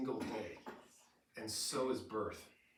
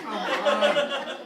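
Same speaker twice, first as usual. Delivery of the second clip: much higher, louder, laughing protest; mock exasperated laugh; 10 semitones higher, 13 dB louder than usual. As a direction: throw it up in playful exasperation. Mock complaint about new baby.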